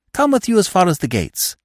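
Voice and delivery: a touch of a limpet's persistence; lightly voiced clinging young man; clinging Freddy. A narrator's reading. Freddy clinging to Eve on the drive, lightly voiced. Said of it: "the human limpet".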